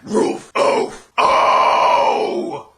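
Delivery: harsh barked false chord scream; barking scream. Shown as a demonstration. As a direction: bark it out like a dog. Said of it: "your little bark thing".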